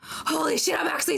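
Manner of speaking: whispered in disbelief; stunned whisper. Real, not acted. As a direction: breathe it out, stunned. Realizing he actually lost.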